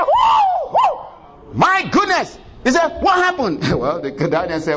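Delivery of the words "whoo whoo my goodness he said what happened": very high whooping surprise; relieved exclamation. A narrator's reading. King hearing Daniel alive, high relieved whoop. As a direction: gasp with joyful relief.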